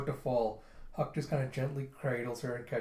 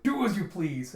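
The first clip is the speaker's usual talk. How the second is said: raised, louder irritated retort; angry; 6 semitones higher, 6 dB louder than usual. Acted. General turning to march off, angry retort. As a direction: throw it out in annoyance. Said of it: "as he turns around angrily".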